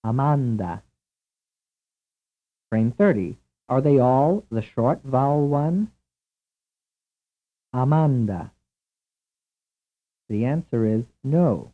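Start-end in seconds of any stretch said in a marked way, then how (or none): 0.0-0.8 Amanda with all vowels the same "ah"; ah-MAHN-dah, three open vowels; Italian pronunciation. Shown as a demonstration. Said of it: "vowel sounds in the Italian pronunciation". 7.7-8.5 Amanda with the same "ah" three times; open-vowelled ah-MAHN-dah; Italian pronunciation. Shown as a demonstration. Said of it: "vowel sounds in the Italian pronunciation".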